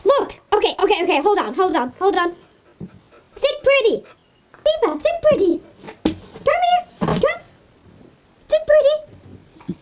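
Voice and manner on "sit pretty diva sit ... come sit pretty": high, sing-song dog commands; coaxing command. Real, not acted. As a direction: coax the dog up into the trick.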